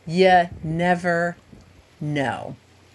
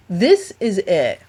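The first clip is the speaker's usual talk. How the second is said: ordinary talk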